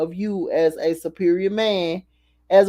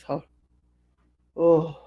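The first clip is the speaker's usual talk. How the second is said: dry heaving; 12 dB quieter, slower than usual. Real, not acted. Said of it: "I'm feeling very nauseous".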